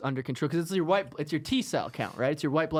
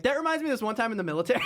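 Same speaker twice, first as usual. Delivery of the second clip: annoying veteran; 7 semitones higher, much faster than usual. Retold or imitated. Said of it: "I would be like".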